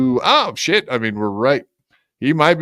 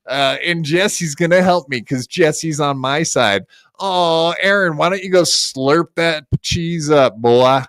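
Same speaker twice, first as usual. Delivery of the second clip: normal talking voice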